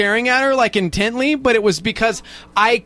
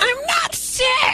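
mocking sick voice; much slower than usual.

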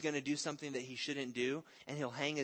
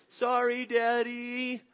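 put-on high, loud tearful little-boy voice; sorry toddler; 7 semitones higher, 6 dB louder, much slower than usual. Little son apologising to daddy after an accident, high and tearful. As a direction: whimper it like a caught toddler. Son caught, starts crying.

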